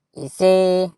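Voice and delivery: normal talking voice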